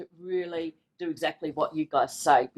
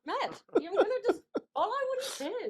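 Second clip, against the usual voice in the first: laughing mock order; 6 dB quieter than usual. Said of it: "I said".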